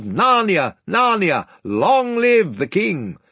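high, drawn-out rousing cheer; crowd's cheering shouts. A narrator's reading. Townsfolk hailing their king, cheering shouts. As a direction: hail the king in long, high cries. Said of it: "hoorays and shouts of".

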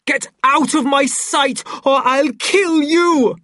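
high, loud rage, drawn out at the end; threatening shout. A narrator's reading. Savage standing over fallen Lenina, murderous shouting. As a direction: roar it as a deadly threat. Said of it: "standing over her threateningly".

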